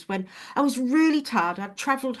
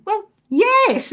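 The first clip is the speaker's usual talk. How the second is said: mock-indignant answer; 11 semitones higher, 8 dB louder than usual. Retelling her answer to husband.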